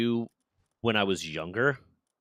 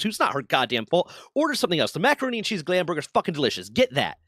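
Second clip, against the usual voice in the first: higher-pitched, exasperated rant; pissed-off retelling; 6 semitones higher than usual. Retold or imitated. Retelling how they scolded a picky-eater buddy, pissed off. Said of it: "I got so pissed at him".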